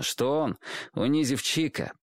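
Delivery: usual speaking voice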